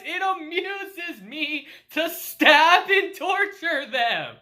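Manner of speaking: slow, high sinister creature voice; evil hedgehog. Acted. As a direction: relish every word like a little villain.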